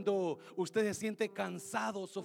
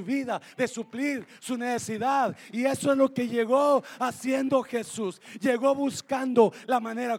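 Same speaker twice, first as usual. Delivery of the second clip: loud, high-pitched, driving sermon; full-force preaching; 6 semitones higher, 11 dB louder than usual. Real, not acted. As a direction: preach it with raised voice, pressing on.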